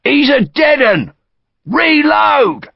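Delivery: high, urgent shouted order; sergeant's shout. A narrator's reading. Sergeant to his men over a fallen soldier, shouting. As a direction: shout it across the ranks. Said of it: "a sergeant shouted".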